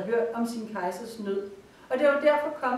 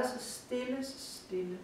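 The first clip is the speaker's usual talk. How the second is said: hushed fade; 2 semitones higher, 10 dB quieter than usual. A narrator's reading. Hushed palace, fading repetition.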